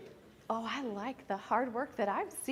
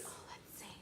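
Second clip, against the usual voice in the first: whisper; 22 dB quieter, much faster than usual. Real, not acted. Aside while finding card.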